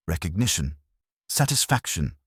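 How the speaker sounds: usual speaking voice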